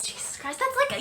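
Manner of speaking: normal talking voice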